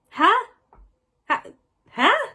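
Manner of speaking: high, sharp disbelieving huh; baffled huh. Real, not acted. Reviewer baffled by light from his eyes, incredulous. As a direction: react as if the line makes no sense.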